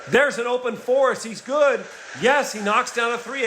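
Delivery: lifted, eager play-by-play burst; excited call. Real, not acted. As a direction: pitch jumps high on the made three. Commentator reacts to big three.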